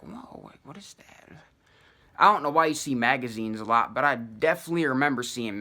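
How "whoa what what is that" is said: hushed, wondering whisper; awed whisper. Retold or imitated. Delirious walker spotting a magazine, whispering in wonder. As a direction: breathe it out in amazement.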